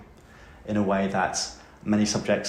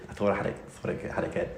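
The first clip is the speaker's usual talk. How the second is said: fast, breathy under-the-breath repeat; quick muttered aside; 2 semitones lower, 5 dB quieter, faster than usual. Retold or imitated. Mocking his old exam confidence, muttered quickly. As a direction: rattle it off to yourself under your breath.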